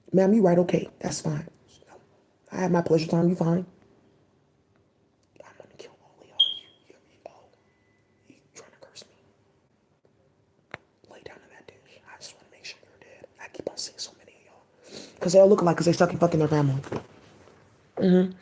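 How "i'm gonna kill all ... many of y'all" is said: barely audible threatening whisper; menacing whisper. Acted. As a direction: breathe the threat under your breath.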